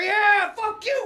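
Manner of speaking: high triumphant yell; beating his brother at a fighting game at last, yelling; victory shout. Retold or imitated. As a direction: scream it jumping out of the chair. Jumped up after winning game.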